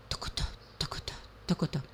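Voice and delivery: quick voiceless tocotó clicks; light fast hoofbeat whisper; whispered trotting. Acted. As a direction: trot it out in a whisper. Horse trots home calmly.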